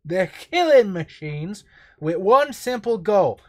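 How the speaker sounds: a slow, loud, high and wobbly Cockney attempt; a Cockney voice that slips away; botched Cockney. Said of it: "went into, uh, Down syndrome for a second".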